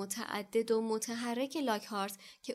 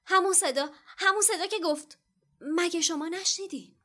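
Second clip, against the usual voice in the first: urgent; 8 semitones higher, 6 dB louder than usual. A narrator's reading. Harry insists urgently.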